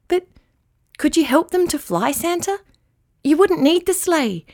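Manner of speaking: put-on a little girl bursting with an idea; excited child. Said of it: "cried Immy".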